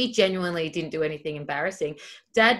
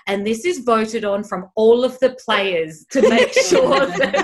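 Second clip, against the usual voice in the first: laughing; 5 semitones higher, 9 dB louder, slower than usual. Retold or imitated. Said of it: "she used to be like".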